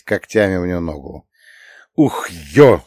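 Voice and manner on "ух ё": exasperated yelp of a curse; heated cursing. A narrator's reading. Master clawed in the leg, heated cursing. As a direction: swear sharply from the sting. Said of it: "выругался я в сердцах".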